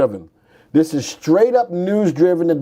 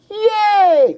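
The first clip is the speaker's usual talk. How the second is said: mock cheer. Cheering Hertz undervalued.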